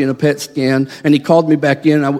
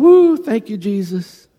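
excited praise; 3 semitones higher, slower than usual. Retold or imitated. Feeling burning, praising Jesus.

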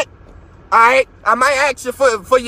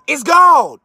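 shouted; 3 semitones higher than usual. Real, not acted. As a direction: yell "It's gold." with full force. Repeats line, second time shouted.

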